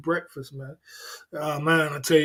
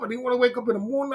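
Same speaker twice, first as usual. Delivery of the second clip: usual speaking voice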